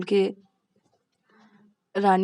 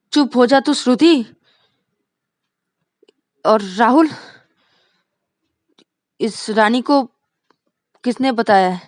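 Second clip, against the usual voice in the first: loud, raised questioning; angry demanding; 4 semitones higher, 7 dB louder than usual. Acted. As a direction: accuse each friend in turn. Vikas angrily questions friends. (गुस्से में सवाल करते हुए)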